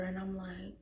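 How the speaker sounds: hushed under the breath; whisper. Real, not acted. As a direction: whisper it off to the side. Whispered aside.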